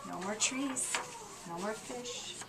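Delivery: storyteller softly mourning what's gone; hushed. Acted. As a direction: say it barely above a breath. Quiet lament after the loss.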